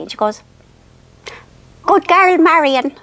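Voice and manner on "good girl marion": put-on high, louder sing-song praise from an old mammy; imitating mother. Mother finally praising her daughter, high mock mammy voice. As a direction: pat her on the head with your voice.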